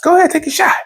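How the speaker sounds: high cocky voice; high confident dare; own quote. Retold or imitated. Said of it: "I'm like".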